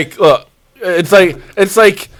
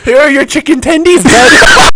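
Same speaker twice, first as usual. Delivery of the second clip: higher, fast mock server voice; hammed-up waiter, lightly higher; 6 semitones higher, 4 dB louder than usual. Voicing the waiter mockingly.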